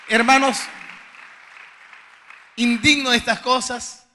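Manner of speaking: very loud, high cry of thanks to God; real exultant shout, in short bursts; shouted praise. Real, not acted. Overcome with praise after healing testimony. (alabanza a gritos, exaltado)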